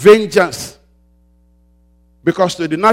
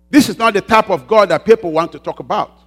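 ordinary talk

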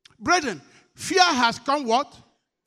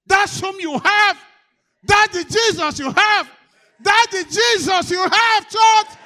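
shouted high and loud to the church; preacher's shout; 9 semitones higher, 9 dB louder than usual. Real, not acted. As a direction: lift it into a full shout.

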